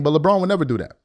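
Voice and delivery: normal talking voice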